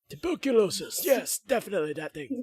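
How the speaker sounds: put-on high, loud, wheezy old man; hammed-up ailing old man; old smoker voice. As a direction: answer as the sickly old smoker. Continues the demonstrated old smoker voice.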